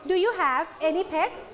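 slow model of "Do you have any pets", ending on a rise; measured "Do you have any pets", pitch climbing on "pets"; rising question tune. Shown as a demonstration. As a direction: lift "pets" at the end for learners to copy. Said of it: "[name]ขึ้นเสียงสูง".

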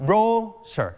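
"brochure" stressed on the first syllable, BRO-sher; British stress on first syllable. Shown as a demonstration. Teacher contrasts the British reading, "brochure" stressed on BRO. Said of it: "คนอังกฤษเขาจะเน้นที่พยางค์แรก".

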